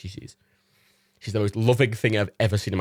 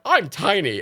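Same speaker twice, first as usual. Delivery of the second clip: lightly voiced dog; 8 semitones higher, slower than usual. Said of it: "my impressions of Reagan and Matilda".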